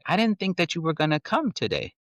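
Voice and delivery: put-on high child's voice; imitated upset daughter. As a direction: lightly imitate a little girl confessing a worry. Retells daughter's sad confession.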